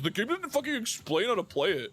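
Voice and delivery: whiny gamer. Retold or imitated. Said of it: "ignores the game's instructions and says".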